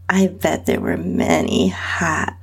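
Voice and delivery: ordinary delivery, nothing marked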